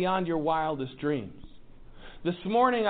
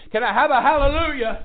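call for hallelujah; 7 semitones higher, 8 dB louder than usual. Acted. Preacher calls out to congregation.

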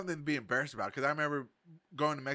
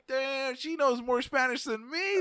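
mock dismay; 12 semitones higher, slower than usual. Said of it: "I'm like".

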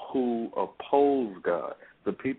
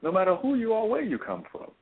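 rising, much higher fervent sermon voice; impassioned preaching; 8 semitones higher than usual. Acted. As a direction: preach it with mounting fire. Preaching again at full force.

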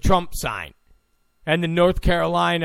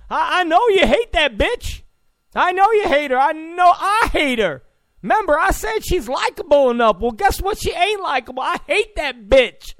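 high, loud mocking Obama voice; mock Obama rant; 10 semitones higher, 4 dB louder than usual. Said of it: "he was doing everything but saying".

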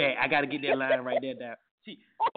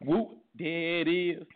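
slow; 2 semitones higher, much slower than usual. Shown as a demonstration. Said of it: "A little louder".

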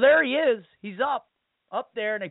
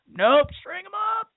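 pitched way up, scornful and quick; dismissive jeer; 12 semitones higher than usual. Retold or imitated. Mob dismissing Jesus's plea, scornfully high. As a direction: toss it off like a cruel heckler. Crowd rejecting Jesus' answer.

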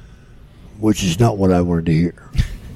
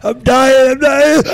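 much higher, loud strangled cry of being crushed; gasping; 11 dB louder, slower than usual. Retold or imitated. Pinned under Andre and unable to breathe, high loud strangled cry. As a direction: squeeze it out as if you can't breathe. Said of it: "I was like".

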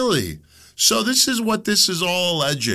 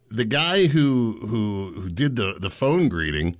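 usual speaking voice

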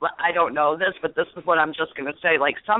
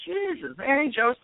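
mock exasperation; 7 semitones higher than usual. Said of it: "I'm going".

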